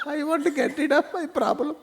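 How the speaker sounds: high, laughing woman's voice; the wife keen to be rid of her trouble; high giggling plea; eager wife. Retold or imitated.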